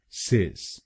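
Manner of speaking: सिस said as sis, softly; syllable demo. Shown as a demonstration. Teacher models the last syllable, sis. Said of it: "बोलेंगे सिस".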